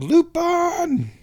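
high, drawn-out gleeful shout of the name; excited exclaim. Real, not acted. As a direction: call out the name with glee. Excited fan of Lupin.

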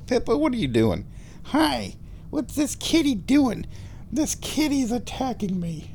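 high-pitched kitty voice; pet talk. Real, not acted. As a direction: play with the kitty in a high, cooing voice. Baby-talk to the cat.

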